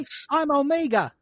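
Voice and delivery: high mock New Zealand accent; Korg. Host mocking Omega's accent, high New Zealand impression. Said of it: "she sounded too much like Korg to not be New".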